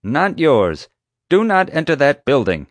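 loud, high, emphatic command; stern order. A narrator's reading. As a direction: forbid it outright over the radio. Chief gives an order.

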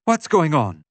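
high, loud, alarmed demand; startled demand. A narrator's reading. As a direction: demand it as if jolted awake. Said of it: "she demanded".

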